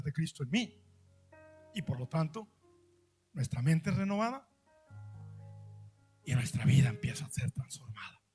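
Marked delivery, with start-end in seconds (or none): 6.2-8.2 whisper. Real, not acted. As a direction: drop to a lower whisper. Whispered close of sermon phrase.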